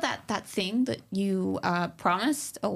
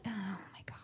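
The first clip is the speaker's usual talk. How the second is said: unvoiced whispered aside; 2 semitones higher, 14 dB quieter than usual. Real, not acted. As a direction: whisper it so nobody hears. Aside while Cody runs.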